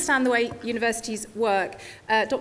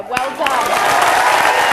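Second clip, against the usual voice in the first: big loud celebratory call; loud cheer; 11 dB louder than usual. Real, not acted. Chancellor cheering hat-waving graduates, loudly. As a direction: shout out congratulations.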